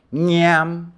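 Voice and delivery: 念 said high and loud as a model; demo pronunciation of 念. Shown as a demonstration. Teacher modelling 念 high and loud for students. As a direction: say 念 crisply for the class. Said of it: "一个口念哈".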